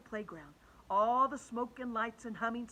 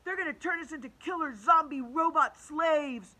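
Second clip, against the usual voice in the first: higher, louder panicked shout; 7 semitones higher, 8 dB louder than usual. Acted. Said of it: "shouted Michael".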